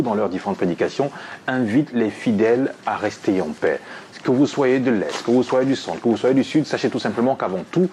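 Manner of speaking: ordinary delivery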